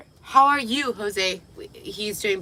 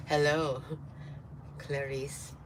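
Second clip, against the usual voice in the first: a hint of a sinister movie villain, much softer; slow, soft menacing purr; creepy greeting; 4 semitones lower, 7 dB quieter, slower than usual. Hannibal Lecter quote.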